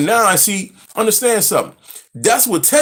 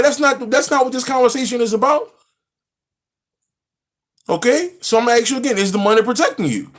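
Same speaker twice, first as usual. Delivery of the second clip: higher, quick mock-annoyed grilling; exasperated retort; 7 semitones higher than usual. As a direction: cut him off, exasperated. Retold arguing with the caller.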